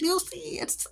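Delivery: squealing way up high; hammed-up shocked reaction, up in falsetto; mock dramatic reaction. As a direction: gasp it out like a scandalized fan. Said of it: "And I'm like".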